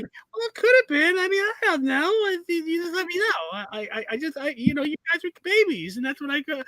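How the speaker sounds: high whiny dad impression; flustered dad. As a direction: fumble excuses as dad. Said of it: "He's like".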